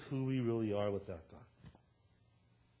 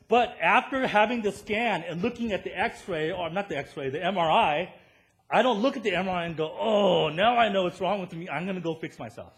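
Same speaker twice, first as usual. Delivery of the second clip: louder, higher, pounding preacher voice; preaching at full force; 7 semitones higher, 11 dB louder than usual. Acted. Pastor pressing the MRI point to his church, full-force preaching. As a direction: hammer the point home to the congregation. Preaching, mocking self-cure idea.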